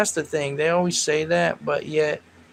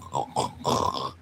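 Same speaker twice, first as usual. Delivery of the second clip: snore imitation; 6 dB quieter than usual. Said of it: "he'd be like".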